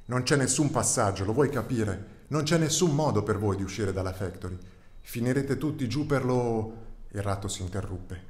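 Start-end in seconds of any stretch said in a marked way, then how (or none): 0.1-6.9 put-on louder, exasperated old rat snapping back; hissing rat. The rat snaps at the pleading calf, louder exasperated. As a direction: shut the question down hard. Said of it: "Il ratto soffiò".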